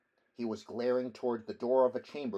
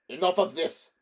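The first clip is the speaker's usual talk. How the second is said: higher, louder angry growl; 7 semitones higher, 10 dB louder than usual. Acted. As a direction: cut them off, fed up. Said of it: "growled Dionys".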